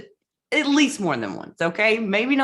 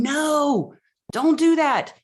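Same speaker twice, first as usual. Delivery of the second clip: loud, high cry of no; retold scream; 8 semitones higher, 4 dB louder, slower than usual. Coach yelling at a client to stop, loud and high. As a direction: shout it to stop a client settling. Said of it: "And I scream".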